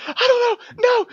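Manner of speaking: very high, crying panic; a tearful wail of refusal; freaking out. Acted. Sammy backing away from the sailors, crying in panic. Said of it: "completely freaking out".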